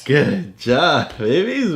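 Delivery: big proud cheer; excited praise. Real, not acted. Parent praising the twins in the book, delighted.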